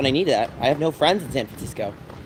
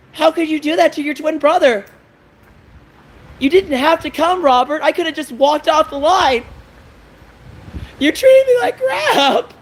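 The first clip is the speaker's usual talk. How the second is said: high, raised voice, pleading then shrieking; upset pleading; 10 semitones higher than usual. Real, not acted. Vlogger rants at his twin brother, hurt and shrill. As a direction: cry out at the twin brother who hit him.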